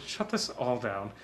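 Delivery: soft, muttered order to shut down. Retold or imitated. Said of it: "looking a little like Walter Peck".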